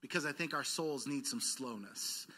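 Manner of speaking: ordinary delivery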